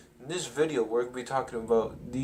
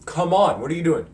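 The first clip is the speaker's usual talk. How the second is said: loud, higher exasperated yell; shouting to stop a bully; retold shout; 5 semitones higher, 11 dB louder than usual. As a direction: call out sharply across the room. Peter yelling at Flash.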